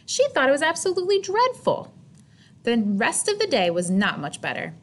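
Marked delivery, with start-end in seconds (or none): 0.0-1.9 dismayed. A narrator's reading. Narrating Chrysanthemum's misery.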